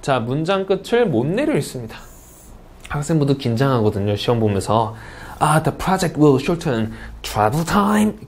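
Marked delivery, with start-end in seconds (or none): 5.4-8.1 slow student reading, "time" left up instead of falling; sentence end not lowered. Retold or imitated. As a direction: imitate a nervous test-taker lifting "travel time" at the end. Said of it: "계속 이렇게 문장 끝 졸려 읽어요".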